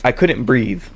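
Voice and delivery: normal talking voice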